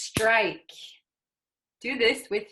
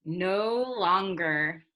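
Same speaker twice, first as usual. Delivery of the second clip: slow model; slower than usual. Shown as a demonstration.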